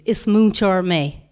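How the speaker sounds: slow, careful demo of "Is muintear mé" with every syllable clear; slow example phrase. Shown as a demonstration. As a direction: say "Is muintear mé" slowly so students catch it. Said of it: "formulation that must be used".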